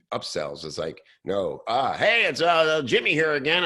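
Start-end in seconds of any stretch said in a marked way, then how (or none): 1.2-3.6 bright, higher, louder salesy pop-in; pushy upseller. Retold or imitated. Marketer mocking an upsell email, higher and louder. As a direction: chirp back in like an unwelcome salesman.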